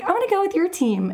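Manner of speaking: high, fast, eager voice of the friend. Retold or imitated. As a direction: pitch it way up and rush it like she can't wait. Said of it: "she's like".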